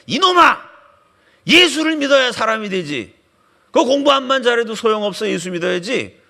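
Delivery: high, loud scolding, easing at the end; imitated old man's yelling. Elderly president scolds students who don't believe, imitated yelling. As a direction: bellow a reprimand, then settle. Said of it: "이 노인네가 소리를 지르더래요".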